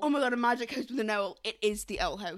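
loud gasping realization; excited realization. Real, not acted. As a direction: shout the discovery. Sudden realization, both excited.

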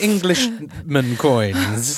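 drawn-out comic phrasing; mock slow. Joking to friend about pounds, slow mock phrasing. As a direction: stretch each word out slowly as a joke.

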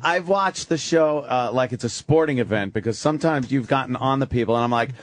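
ordinary talk, nothing marked

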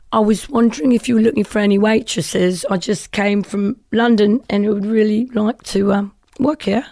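put-on British accent for a job request. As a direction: ask politely in an imitated British accent. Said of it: "talk with a British accent".

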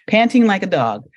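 laughing, high and bright. Real, not acted. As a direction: giggle through the words. Laughs at the dog image.

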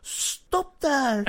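high, put-on hero voice; piercing mock-heroic challenge; heroic halt call. Hero calls halt to villains.

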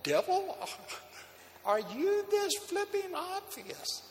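mocking. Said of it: "I laughed because I saw".

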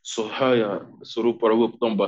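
usual speaking voice

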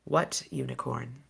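put-on quiet rasping question from the old fairy; rasped. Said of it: "Her voice rasped".

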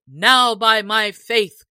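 loud, slow exclamation; sheriff crying out. Acted. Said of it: "cried the sheriff".

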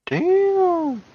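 high, drawn-out amazed damn; surprised. Real, not acted. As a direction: blurt it out in shock. Reacting to Grogu's power.

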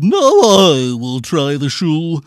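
loud, slow, drawn-out bellow; big bellowing ugly-sister voice; yelled. A narrator's reading. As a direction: yell it out, stretching the words. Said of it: "who yelled".

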